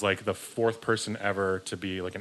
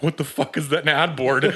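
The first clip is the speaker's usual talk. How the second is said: baffled; 6 semitones higher, 6 dB louder than usual. Retold or imitated. Retold own baffled reply.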